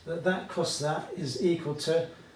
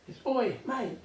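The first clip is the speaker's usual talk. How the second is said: high, hammed-up British "oi, mate"; mock British; 9 semitones higher than usual. Teacher mimicking a typical British call, hammed-up British accent. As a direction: play a cheeky British bloke shouting "oi, mate". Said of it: "that's typically British".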